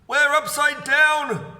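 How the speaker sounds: high, loud, slow gasp of horror; Mister Twit realising they are upside down; gasping alarm. A narrator's reading. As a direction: gasp it out in disbelief. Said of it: "gasped Mister Twit".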